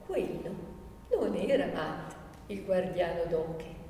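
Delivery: soft, low and silky; caressing voice. Acted. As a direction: murmur it low, as if stroking him. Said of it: "disse con voce carezzevole".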